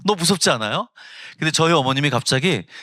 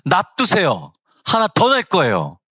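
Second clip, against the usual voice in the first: raised, firm refusal; wife snapping back at the doctor, louder and higher; indignant retort; 6 semitones higher, 4 dB louder than usual. Retold or imitated. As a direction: push back hard at the doctor.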